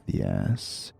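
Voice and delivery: usual speaking voice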